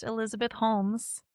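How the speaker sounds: ordinary delivery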